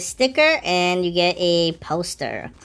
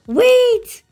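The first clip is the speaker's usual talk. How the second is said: high, loud yelp; excited disbelief; 13 semitones higher, 6 dB louder than usual. Real, not acted. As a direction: cry out as if spotting a prize. Thinks he found a free code.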